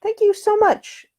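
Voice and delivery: high, slow ladylike thanks; lightly voiced gracious lady; grateful lady. A narrator's reading. Passenger thanking the porter, ladylike and high.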